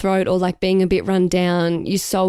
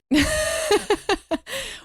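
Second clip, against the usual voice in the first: high laugh; 4 dB quieter than usual. Real, not acted. Laughs at sister's teasing.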